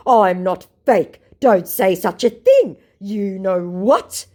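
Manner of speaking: loud, slow, high indignant scammer; mock scammer voice. Mocking fake scammer's denial, slow and loud. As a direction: plead slowly like an offended fake. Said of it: "To which my fake said".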